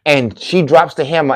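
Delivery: ordinary delivery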